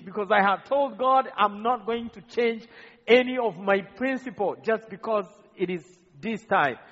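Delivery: ordinary talk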